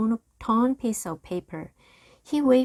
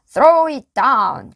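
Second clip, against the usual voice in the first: loud slow command; cried out; 7 semitones higher, 12 dB louder, slower than usual. A narrator's reading. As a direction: demand it loudly and slowly. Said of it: "cried Captain Bones".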